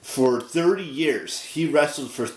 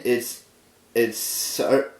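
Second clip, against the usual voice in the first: normal talking voice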